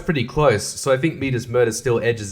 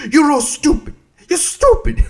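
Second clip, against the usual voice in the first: much higher and louder, a mocking squeak; squeaky taunt; 14 semitones higher, 6 dB louder than usual. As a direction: tease them in a cartoonish squeal.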